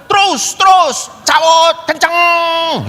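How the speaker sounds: high, loud urging shout; Anton yelling from the back. Retold or imitated. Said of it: "dia di belakang".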